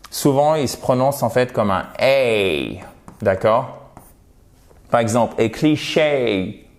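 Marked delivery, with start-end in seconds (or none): 5.4-6.6 cliché said slowly the English way, stress on the last syllable; cliché pronounced kli-SHAY like an English word. Shown as a demonstration. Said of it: "vous voyez, a cliché". (cliché à l'anglaise, accent sur la dernière syllabe)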